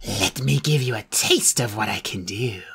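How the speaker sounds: low whispered menacing croak; harsh croak. Acted. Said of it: "her voice was a harsh croak".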